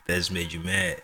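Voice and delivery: ordinary talk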